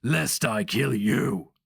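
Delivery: slow, loud roaring threat; roared. A narrator's reading. Barbarian roars his threat at the sorcerer, loud. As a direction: roar the threat, slow and heavy. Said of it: "the barbarian roared".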